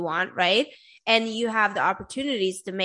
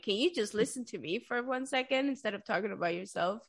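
quiet plea; 10 dB quieter, faster than usual. Retold or imitated. Asking mom to listen.

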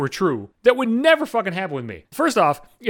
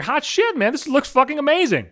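pitched way up in mock awe; excited; 7 semitones higher than usual. Runner spotting the waterfalls during his race, voiced high with excitement. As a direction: gush like a runner who just spotted the falls. Sees waterfalls mid-run.

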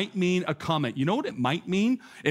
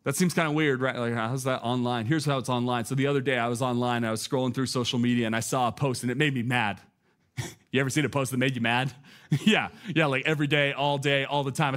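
usual speaking voice

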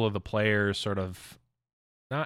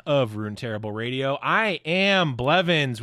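announcer; 10 semitones higher, 8 dB louder than usual. Acted. Show intro announcement.

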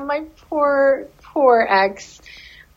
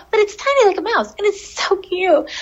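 cutesy gushing; 10 semitones higher than usual. Real, not acted. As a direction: melt over something adorable. Gushing over the kangaroo rat.

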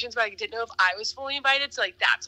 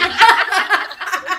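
big burst of real laughter; loud laugh; 8 semitones higher, 10 dB louder than usual. Real, not acted. Laughing loud about ghosting her date.

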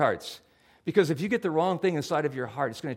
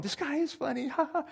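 high, giggly retold laugh at a comedian; laughing along; 11 semitones higher, 4 dB quieter than usual. Pastor recalling watching a raunchy Netflix comedian, high laughing. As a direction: chuckle like you're hooked on the joke. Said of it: "and I'm going".

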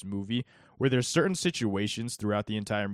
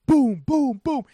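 door pounding noises; 14 semitones higher, 10 dB louder, slower than usual. Retold or imitated. Mimicking someone pounding on door.

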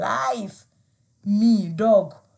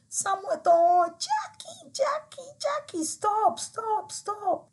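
much higher, drawn-out calling after someone; 14 semitones higher, slower than usual. Retold or imitated. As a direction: call her back from afar. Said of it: "my cousin calling me".

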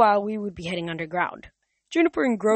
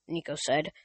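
usual speaking voice

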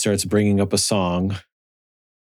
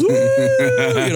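high whooping cheer; crowd cheer. Retold or imitated. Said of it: "the whole crowd goes".

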